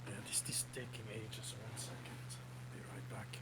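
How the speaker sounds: quiet unvoiced whisper. Real, not acted. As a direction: whisper it, stepping away. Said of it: "whispering aside while waiting".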